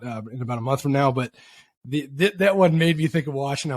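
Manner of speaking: ordinary delivery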